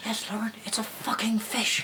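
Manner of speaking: hushed, breathy whisper of a school quote. Retold or imitated. Classmate whispering the fish line at the back of class, hushed.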